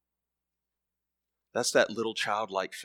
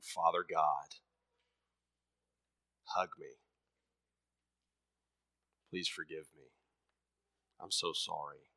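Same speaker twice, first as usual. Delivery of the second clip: tender prayer; 7 semitones lower, 8 dB quieter than usual. Acted. Believer asking Father God for a hug, soft and humble. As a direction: pray quietly like a sorry child.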